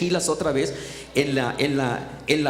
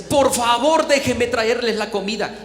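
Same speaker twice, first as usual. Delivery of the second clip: louder, higher plea, retold; earnest insistence; 5 semitones higher, 5 dB louder than usual. Stranger offering food to the workers, earnest insistence. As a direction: plead eagerly to be allowed.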